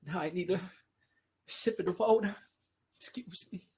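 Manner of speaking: ordinary delivery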